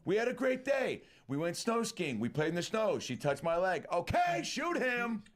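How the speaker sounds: hammed-up Dumb and Dumber line; mock gangster recap, slowing at the end; high movie quote impression. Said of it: "Dumb and Dumber".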